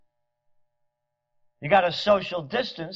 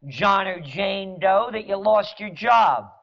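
ordinary talk, nothing marked

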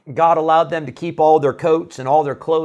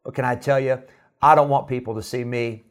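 ordinary delivery

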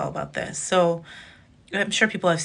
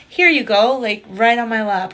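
higher, louder offering tone; lightly voiced God; 5 semitones higher, 7 dB louder than usual. God handing the comic a joke, cheerful offer. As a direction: hand it over like a gift. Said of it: "Just like".

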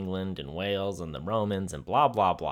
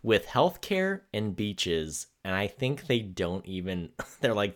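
ordinary talk, nothing marked

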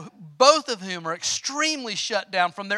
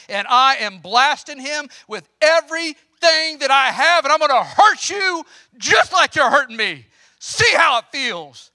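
put-on loud, raised recreation of a teen tantrum; retold tantrum; 6 semitones higher, 7 dB louder than usual. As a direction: blast him like the furious sixteen-year-old you were. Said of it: "hissy fit temper tantrum".

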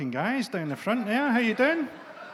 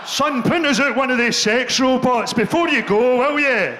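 a louder, higher pestering dad; dad nagging his son to print a sex robot, loud and pestering; shouty old dad; 5 semitones higher, 5 dB louder than usual. Pretend. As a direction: call out to your son like a flustered father. Dad voiced asking son.

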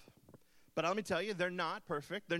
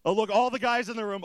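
usual speaking voice

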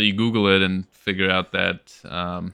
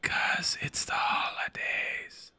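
slow drawn-out whisper; 12 dB quieter, much slower than usual. Acted. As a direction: hush it out slowly. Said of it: "Why are you whispering?".

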